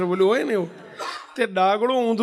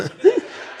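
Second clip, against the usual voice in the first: a sudden high burst of laughter; real high laugh; 11 semitones higher than usual. Real, not acted. Laughs at his own story. (ઊંચા અવાજે સાચું હસવું)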